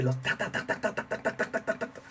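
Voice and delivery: quick breathy tac tac like a blast beat; rapid drum imitation. Shown as a demonstration. Describing blast beats, rapid whispered drum sounds. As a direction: tap out a fast blast beat with the mouth. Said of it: "las ráfagas de golpe".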